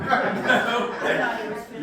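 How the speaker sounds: laughing loudly over a glitch. Real, not acted. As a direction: burst out laughing while talking. Mic failure laughter at wedding.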